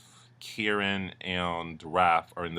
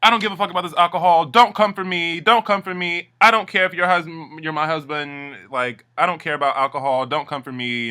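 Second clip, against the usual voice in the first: much higher, louder fast yelling; mock tantrum; 8 semitones higher, 7 dB louder than usual. Suki raging at Imran over the alcohol, loud high yell. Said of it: "Suki loses her fucking mind".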